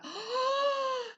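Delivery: a high, sudden gasp of shock; sharp gasp. Retold or imitated. Retelling the moment she saw her toes, shocked gasp. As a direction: gasp as if you can't believe your eyes.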